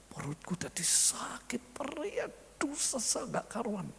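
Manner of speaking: breathless whisper of a man with stomach pain. Acted. Husband tells his wife his stomach hurts, in a pained whisper. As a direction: groan it out, barely voiced, hurting.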